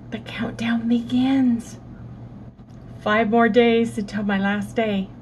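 slow whispered announcement; dramatic whisper. Acted. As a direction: breathe it like a trailer line. Dramatic countdown to last day.

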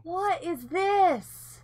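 slow, loud, high surprised question; drawn-out astonished what is this. Real, not acted.

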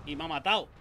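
normal talking voice

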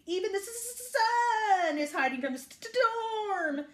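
high, stuttering wail; bleating sheep. A narrator's reading. Sheep dreading the hidden sun, bleating. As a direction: stammer and bleat, close to tears. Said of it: "bleated the sheep".

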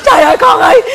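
high, loud mock wail; wailing cry. Mother wailing over her pregnant daughter, hammed-up cry. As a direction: howl it like a stricken mother.

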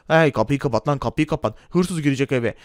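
low, bossy shopkeeper voice; gruff shopkeeper. Acted. Shopkeeper Erdal Bakkal teasing the customer, low gruff voice. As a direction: play the pushy corner-shop owner, pitched low.

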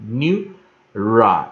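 "neuri" said slowly, syllable by syllable; syllable demo. Shown as a demonstration. Teacher shows I read as long I, syllable demo. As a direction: split "neuri" in two with a long I. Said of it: "所以I就念I".

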